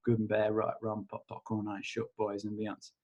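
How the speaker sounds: tossed-off chant, dropping away; quick mumbled incantation. Acted. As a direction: rote clearing phrase, murmured. Recites clearing statement.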